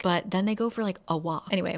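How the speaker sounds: usual speaking voice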